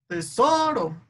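teSOro, SO high and drawn out; stress on middle syllable. Shown as a demonstration. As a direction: exaggerate the SO in "tesoro". (tesoro marcando mucho la sílaba SO)

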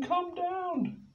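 very high call; Penny calls Og down, very high. Acted. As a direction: call up to him.

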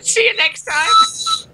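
very high falsetto outro; mock sign-off. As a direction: squeal a joke goodbye to the viewers. Mock sign-off to cut short podcast.

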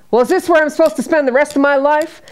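loud, high indignant demand; angry father. Retold or imitated. Father brought home to die, loud indignant demand.

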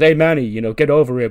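loud call from across the room. Acted. Dio calling Manny back to the group, loud call. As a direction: shout it over to him.

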